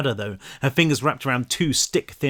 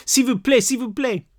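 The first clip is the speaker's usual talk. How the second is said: high, desperate French plea; begging French girl; 11 semitones higher than usual. Acted. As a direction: beg like a terrified French child. Voices the frightened French girl begging.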